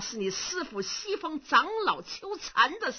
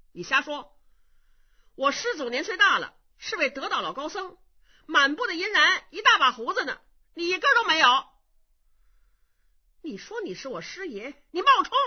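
louder and higher, building to a shout; indignant young monk; 6 semitones higher, 6 dB louder, faster than usual. Acted. Young monk accusing the beardless old man, indignant. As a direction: call out the fraud with rising outrage.

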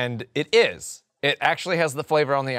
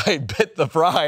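ordinary talk

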